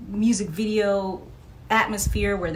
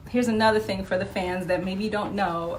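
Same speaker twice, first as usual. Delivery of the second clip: ordinary talk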